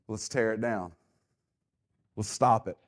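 low, soft sinister whisper-like voice of the enemy; scheming enemy. Acted. Preacher voicing the enemy plotting to stop the work, low and hushed.

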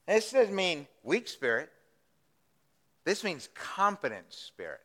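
normal talking voice

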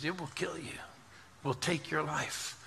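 soft, grave warning; hushed retold warning. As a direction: drop the volume and let the danger sink in. Retold doctor's deadly warning.

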